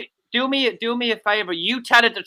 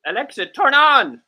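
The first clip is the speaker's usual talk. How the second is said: higher, louder second attempt; insistent, raised-voice command; firmer command; 6 semitones higher, 5 dB louder than usual. Shown as a demonstration. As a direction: raise the voice to make the gadget obey. Repeats failed command more forcefully.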